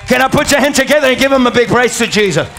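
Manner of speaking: rally the congregation to applaud, words rushing out; rousing call for praise. Real, not acted. Preacher calls congregation to applaud.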